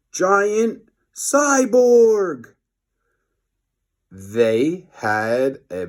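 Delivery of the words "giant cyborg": slow, higher and louder reveal; dramatic reveal. A narrator's reading. Narrator unveils the giant cyborg, dramatic. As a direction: stretch it out for the big reveal.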